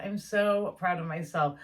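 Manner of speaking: ordinary talk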